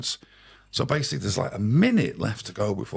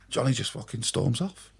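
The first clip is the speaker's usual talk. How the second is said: usual speaking voice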